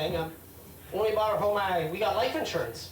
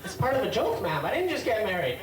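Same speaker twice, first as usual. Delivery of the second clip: usual speaking voice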